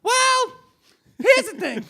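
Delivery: high-pitched comic interviewee; man on the street. Pretend. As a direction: launch into it high and indignant. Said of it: "I'm the man on the street".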